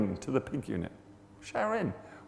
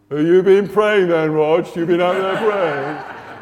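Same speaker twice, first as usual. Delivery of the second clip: loud, drawn-out sing-song jeer; mocking; 5 semitones higher, 10 dB louder, slower than usual. Fifteen guys jeering as Rog walks in late, mocking. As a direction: jeer it high and slow like a gang of workmates. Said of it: "they're all mocking me".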